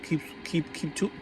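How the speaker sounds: usual speaking voice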